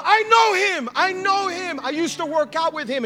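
very high, loud imitated cry of recognition; overwhelmed outburst. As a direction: shout it in amazement, easing slightly. Acted man overwhelmed at the name.

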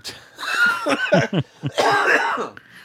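high loud shrieking laugh. Real, not acted. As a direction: burst into a screaming laugh. Big laugh at joke.

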